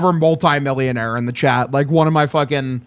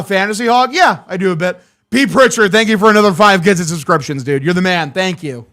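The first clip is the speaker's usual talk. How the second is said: ordinary delivery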